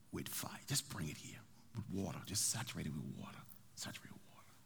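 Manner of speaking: low, breathy hushed commands; whispered orders. Acted. Elijah whispering orders to build the altar. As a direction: give the orders in a secretive whisper.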